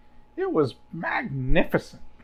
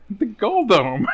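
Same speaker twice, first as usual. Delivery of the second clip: laughing; 5 semitones higher, 7 dB louder, slower than usual. Real, not acted. Laughs while saying it.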